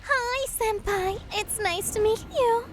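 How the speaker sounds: sing-song like a tiny cute VTuber; sweet high uwu greeting. Shown as a demonstration. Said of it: "your uwu VTuber voice".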